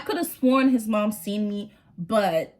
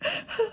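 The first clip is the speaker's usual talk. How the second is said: mock gasp; 5 dB quieter than usual. Panicked prayer on train.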